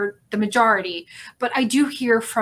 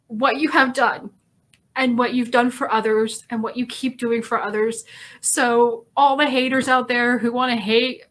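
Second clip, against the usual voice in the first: ordinary talk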